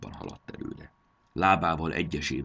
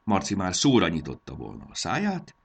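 plain reading voice

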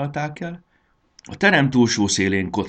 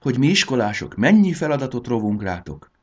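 raised, louder voice of wonder; astonished realisation, pitched up; amazed; 7 semitones higher, 6 dB louder than usual. A narrator's reading. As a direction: marvel out loud at it. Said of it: "ámuldozott Jakab".

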